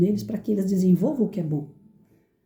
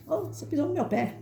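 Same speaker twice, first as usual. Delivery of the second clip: higher-pitched indignant complaint, lightly acted; mock indignation; 8 semitones higher, 5 dB quieter than usual. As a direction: play a touchy, mock-angry protest. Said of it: "aquela ira do cachorro ruim".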